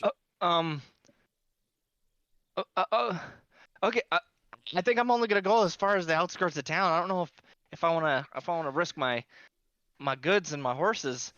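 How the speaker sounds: lightly voiced nervous merchant; nervous caravan master.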